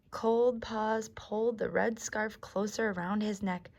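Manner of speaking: plain reading voice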